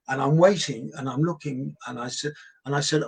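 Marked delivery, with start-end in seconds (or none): none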